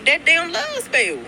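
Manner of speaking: high, slow mocking drawl; sarcastic. Real, not acted. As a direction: stretch it out with sarcasm.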